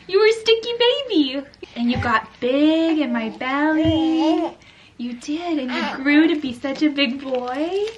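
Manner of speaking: put-on high, sing-song story told to a baby; telling a little one a bedtime-like tale; storytelling to a baby. Mother tells her baby son how he began, in a high sing-song voice.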